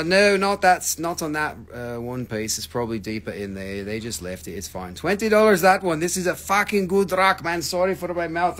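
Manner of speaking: normal talking voice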